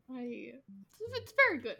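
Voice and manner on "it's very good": laughing. Real, not acted. Laughing over the show's puns.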